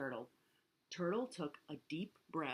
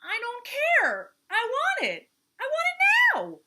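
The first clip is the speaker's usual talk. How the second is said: very high, loud whine building higher; moaning tantrum; 13 dB louder than usual. A narrator's reading. Turtle demands the bracelet, moaning high and loud. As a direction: wail the demand, climbing to a squeal. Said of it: "Turtle sniffed and moaned".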